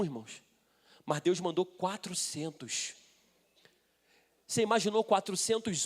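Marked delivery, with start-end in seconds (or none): none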